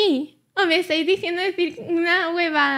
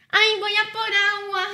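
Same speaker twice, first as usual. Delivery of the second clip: playful higher-pitched impression; teasing, raised mimicking voice; Andy; 4 semitones higher than usual. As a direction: mimic someone announcing a water run in a put-on higher voice. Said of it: "¿Cómo, cómo es, Andy?".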